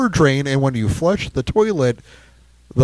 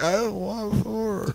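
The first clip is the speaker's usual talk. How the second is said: small, slow, much softer voice; retold meek protest; 6 semitones higher, 11 dB quieter, slower than usual. Handed an order of four at KFC, meek protest. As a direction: protest feebly, slow and hushed.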